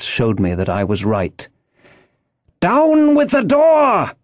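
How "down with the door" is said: high, drawn-out shouted command; urgent high-pitched yell; blind man's cry. A narrator's reading. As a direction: bellow the order high and slow. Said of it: "he cried".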